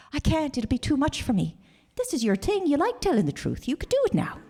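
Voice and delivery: high-pitched imitation of mom; retold pleading mother. Mom hands her child the hard task, high and pleading.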